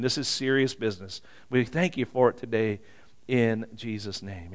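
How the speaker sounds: prayer. Real, not acted. Leads a prayer.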